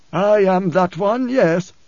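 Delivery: high, very slow admission; fearful preacher. A narrator's reading. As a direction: admit it with a touch of fear. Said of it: "there was fear in the preacher's voice".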